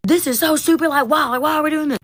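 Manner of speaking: put-on high, whiny teen complaining; hammed-up imitation of students griping, pitched high; whiny students mocked. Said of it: "like, yelling at me".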